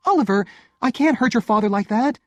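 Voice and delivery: distressed wife objecting strongly; upset Jenny protesting. Acted. Jenny protests refusing the invitation.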